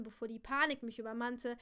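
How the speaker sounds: usual speaking voice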